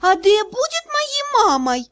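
put-on high, slow whiny child voice; pouty little girl asking; capricious little girl. A narrator's reading. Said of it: "капризно спросила та девочка".

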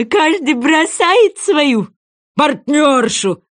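high, slow, stretched call; crying out. A narrator's reading. Sea wolf shows how partners are thrown, crying out. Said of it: "закричал морской волк".

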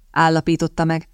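usual speaking voice

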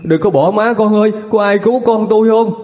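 mother waking in terror for her son; crying out. Acted. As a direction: call out for her son in panic. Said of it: "Bà hai bật ngồi dậy kêu to".

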